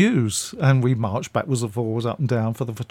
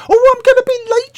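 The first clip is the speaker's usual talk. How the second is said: much higher, louder put-on voice of an elderly actress, fussing; Patricia Hayes; 9 dB louder than usual. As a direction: pitch it way up and fret at the policeman. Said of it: "she said".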